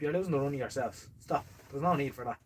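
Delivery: ordinary talk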